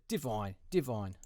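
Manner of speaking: slow Australian "Divine", vowel toward "voin"; drawn-out "Divine" in Australian or New Zealand accent; imitated Australian accent. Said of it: "Australian or from New Zealand".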